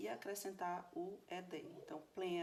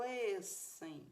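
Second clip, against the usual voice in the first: LIS-ten, first syllable drawn out very slowly; stress on first syllable; 2 semitones higher than usual. Shown as a demonstration. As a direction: stretch and lean on the LIS of "listen". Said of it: "a sílaba tônica vai tá aqui no início". ("listen" lento, com a primeira sílaba tônica bem alongada)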